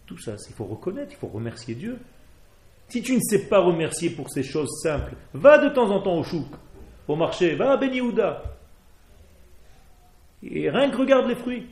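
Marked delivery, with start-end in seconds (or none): 2.9-11.7 louder, much higher, pleading to look; fervent urging. Real, not acted. Rabbi urging listeners to visit the shuk, fervent and loud. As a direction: push them out to the market with fervour. Urging students to see the market.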